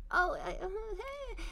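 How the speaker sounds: high, faltering mock nervous hey; flustered stammer. A fan recognizes her, retold flustered high stammer. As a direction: squeak it out, caught off guard. Retelling her awkward freeze.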